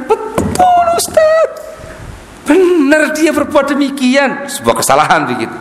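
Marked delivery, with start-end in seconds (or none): 0.1-4.5 very high put-on voice, slow at the end; eager informant. Follower confirming the rumour to the ustaz, very high squeaky voice.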